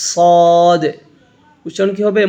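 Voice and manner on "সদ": "সদ" with a heavy hissing s and long rounded o; thick hissing sound. Shown as a demonstration. Teacher showing the thick letter, heavy "সদ". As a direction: hiss "সদ" thick, full and drawn out. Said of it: "শিরশির ও মোটা আওয়াজ হবে".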